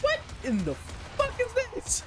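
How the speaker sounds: baffled, pitch shooting up high; surprised. Real, not acted. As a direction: react in disbelief to something odd. Sudden surprise at game item.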